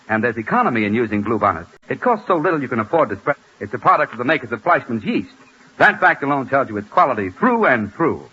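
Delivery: usual speaking voice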